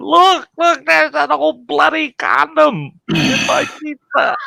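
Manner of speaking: very high, loud mock shriek; hammed-up squealing panic; imitated horror. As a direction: wail the fake discovery slowly for everyone to hear. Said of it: "And she points at it".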